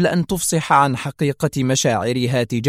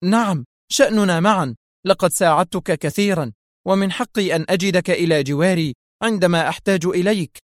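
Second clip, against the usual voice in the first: higher-pitched, emphatic demand; insistent cry; 6 semitones higher than usual. A narrator's reading. Woman claims the agent's help as her right, crying out insistently. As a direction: cry it out, insisting on your due. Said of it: "هتفت".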